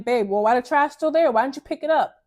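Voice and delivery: loud, abrupt complaint; harsh nagging. Shown as a demonstration. Woman nagging her babe about the trash, loud and harsh. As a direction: scold your partner bluntly. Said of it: "so abrupt and harsh".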